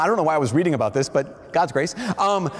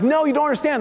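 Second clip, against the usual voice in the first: high-pitched frustrated insisting; exasperated insistence; 9 semitones higher, faster than usual. Retold or imitated. Teen insisting to his siblings, high and exasperated. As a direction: push back hard, pitch up. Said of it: "I'm like".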